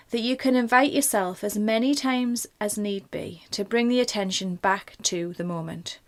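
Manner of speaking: ordinary delivery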